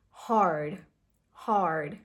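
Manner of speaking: "hard" with a strong curled R; "hard" drawn out on a heavy R; strong American R. Shown as a demonstration. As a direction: roll the tongue upward in "hard". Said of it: "it's a strong ra sound".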